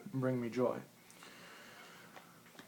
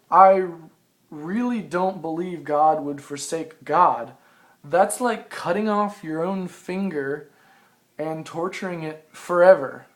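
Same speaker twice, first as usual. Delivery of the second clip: slow, louder reading of own writing; reading aloud; 2 semitones higher, 6 dB louder than usual. Acted. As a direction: read it out deliberately, slowly. Reads own written passage aloud.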